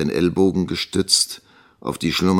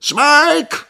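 high, loud roared name; roaring; 8 dB louder than usual. A narrator's reading. Schoolmaster calling the missing boy, roaring. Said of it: "brüllte Squeers wieder".

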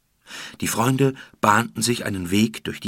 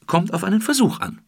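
bright, breezy confidence, pitched up; carefree; 10 semitones higher, 4 dB louder than usual. Acted. Kim shrugs off the guards, carefree and upbeat. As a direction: toss it off lightly, no worries. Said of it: "Kim lief unbekümmert".

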